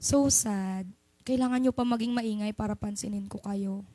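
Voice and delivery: low, quiet and let down; gently guilt the noisy class; soft sad disappointment. Shown as a demonstration. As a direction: sigh it out as if hurt, not angry. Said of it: "ganito ginagawa ko".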